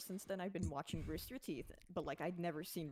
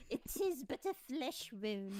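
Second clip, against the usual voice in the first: slow, higher mock-heroic knight voice; Monty Python knight; 6 semitones higher, 3 dB louder than usual. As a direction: shrug off a fatal wound, slowly. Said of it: "she's like".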